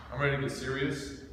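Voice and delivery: usual speaking voice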